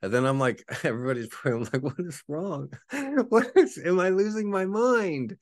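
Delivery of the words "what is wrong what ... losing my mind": high, loud, bewildered pleading; high, frantic confusion; confused panic. Retold or imitated.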